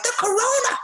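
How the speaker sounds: very high spooked voice; mock corona panic. Mocking a coughing suspect, high panicked voice. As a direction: squeak it like a fake victim. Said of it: "You can be like".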